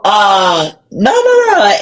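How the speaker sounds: fighting back a cry while saying no; overcome, voice breaking into a sob; choked up. Real, not acted. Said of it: "getting emotional about it".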